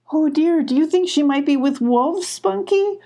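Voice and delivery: put-on high, worried child's voice; frightened cry. Abby fearing wolves took Molly, frightened cry. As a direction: cry out in fear for her sister. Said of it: "cried Abby".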